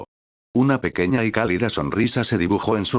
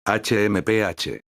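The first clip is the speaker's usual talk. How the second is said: slow, drawn-out pouty hmph; a sulky hmph; huff; slower than usual. A narrator's reading. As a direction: huff it out like a sulking girl. Mira huffs, pretending not to care.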